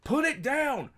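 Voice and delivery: high, loud shout at the TV; retold yell. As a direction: yell at the screen to end it. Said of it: "me and my wife were yelling".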